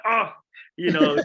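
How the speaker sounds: high, exasperated outburst, imitating online commenters; mock groan of annoyance, high and loud; annoyed. Filmmaker imitating viewers fed up with the boy, annoyed. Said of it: "people are so annoyed with him".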